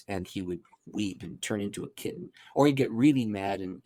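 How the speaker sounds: normal talking voice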